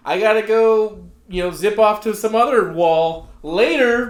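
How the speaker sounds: pharaoh character quote. Acted. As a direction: announce a grand exit. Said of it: "he's like".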